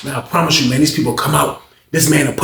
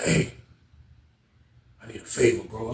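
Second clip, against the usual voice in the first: low secretive near-whisper, much softer; hushed whisper; 4 semitones lower, 12 dB quieter than usual. Retold or imitated. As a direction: lean in and keep it secret. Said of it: "why you talking like that".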